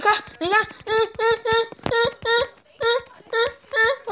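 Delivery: high sing-song taunt; high teasing hunter chant; taunting call. Acted. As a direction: lure him out teasingly. Hunting player, taunting call.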